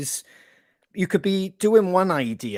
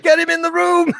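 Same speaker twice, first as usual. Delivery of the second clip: much higher, louder mock shout with a laugh; hammed-up producer calling him in; excited producer; 13 semitones higher, 7 dB louder than usual. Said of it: "and Clancy was like".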